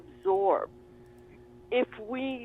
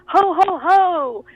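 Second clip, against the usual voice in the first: booming Santa laugh, high and loud; 8 semitones higher, 8 dB louder, slower than usual. Retold or imitated. Client brushing off relatives all weekend, jolly Santa boom. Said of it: "all she will continue to do the whole weekend is say".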